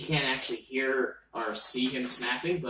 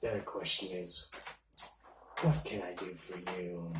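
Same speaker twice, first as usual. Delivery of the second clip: mysterious entity voice; 6 semitones lower, 10 dB quieter, faster than usual. Acted.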